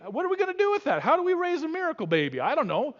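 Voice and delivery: high, fast, flustered father; Samson's dad fretting to his wife, high and fast. Retold or imitated. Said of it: "Samson's dad was like".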